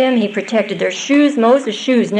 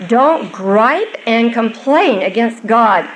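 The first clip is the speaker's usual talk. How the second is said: usual speaking voice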